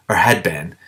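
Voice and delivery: ordinary delivery, nothing marked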